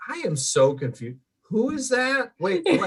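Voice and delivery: rising into high-pitched confusion; mock bewilderment. Host recalling watching X three in theater, mock bewildered. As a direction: act out being totally lost.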